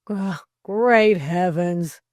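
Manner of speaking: slow, loud exasperated groan. A narrator's reading. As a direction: groan it out heavily. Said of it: "groaned Delcote".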